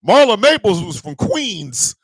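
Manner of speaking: ordinary talk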